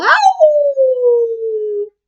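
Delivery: very high, loud wolf howl; terrified howl. Acted. Wolf fleeing out the window, loud terrified howl. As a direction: howl in terror as the wolf flees. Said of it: "With a terrified howl".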